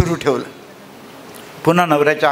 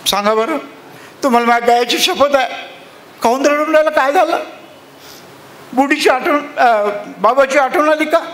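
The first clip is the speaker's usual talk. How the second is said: worried wife pleading; 8 semitones higher than usual. Acted. Wife anxiously begs her sobbing husband. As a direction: beg him to say what's wrong.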